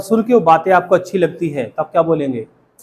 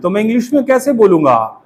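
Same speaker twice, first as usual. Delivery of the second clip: ordinary talk, nothing marked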